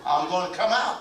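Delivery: lightly voiced threatening tooth, loud; talking tooth. Tooth warning its neglectful owner, mock loud threat. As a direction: call out a comic warning as the tooth. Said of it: "The tooth is trying to tell you something".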